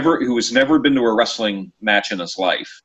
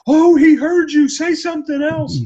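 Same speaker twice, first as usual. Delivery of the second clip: very high, louder squeal of an excited woman; egging woman; 5 dB louder than usual. Retold or imitated. Woman beside him urging him to keep heckling, squealing. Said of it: "the gal next to me is egging me on, going".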